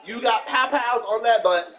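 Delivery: high, loud mock-stern orders; retold parental scolding. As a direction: scold the child off to bed, playfully hammed up. Acts out scolding son to bed.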